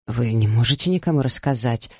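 ordinary delivery, nothing marked

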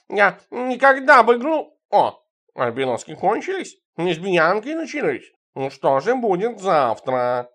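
slow, drawn-out rant starting high and loud; indignant cat. A narrator's reading. Cat Tikhon rants about the narrator's women, slow indignation. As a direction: rant slowly as an outraged cat.

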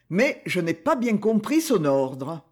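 usual speaking voice

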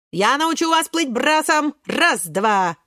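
hearty swimming instructor call; commanding. A narrator's reading. Огурчик teaching friends breaststroke, commanding call. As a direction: count them in like a coach. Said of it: "командует Огурчик".

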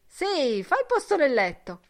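high, loud lively call. A narrator's reading. Mulligan to the young man in the water, calling out loudly. Swimmers calling to each other.

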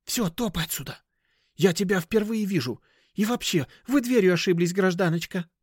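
high, hissy send-off; irritable creature disowning a customer; shady dealer, dismissive. Acted.

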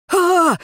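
high, loud frightened wail; wailing cry. A narrator's reading. Tracker sees the creature, wailing cry. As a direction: cry out in alarm. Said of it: "заголосил следопыт".

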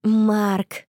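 understated happy exclamation. A narrator's reading. Girl reveals who is in the photo, exclaiming. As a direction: announce the name with contained glee. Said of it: "воскликнула Анна".

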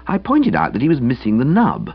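usual speaking voice

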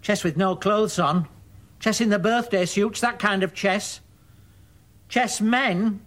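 high, loud and pointed; scornful accusation. Acted. Mother mocking her son's magazines, scornful accusation.

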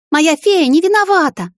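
high, loud pleading cry; desperate child shout. A narrator's reading. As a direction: cry out defending her. Child defends the witch. (отчаянный детский крик)

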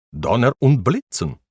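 high, loud angry German oath; German curse. Acted. Baron cursing at the narrator, loud and angry. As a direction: spit it out like an outraged baron.

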